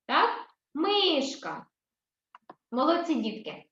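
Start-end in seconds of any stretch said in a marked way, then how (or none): 0.7-1.7 "Мишка" with МИ stretched and raised; stress on first syllable. Shown as a demonstration. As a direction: hold the stressed МИ high in "Мишка". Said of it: "На перший, так, ось, на склад ми". («Мишка» з високим протяжним наголосом на МИ)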